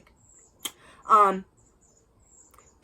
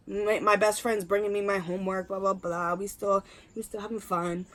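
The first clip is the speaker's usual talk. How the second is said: ordinary talk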